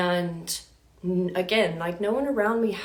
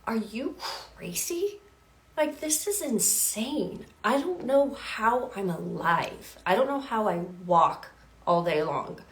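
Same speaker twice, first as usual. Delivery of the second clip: slow, hushed disbelief; incredulous inner thought; 3 semitones higher than usual. Retold or imitated. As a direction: whisper it to yourself in shock.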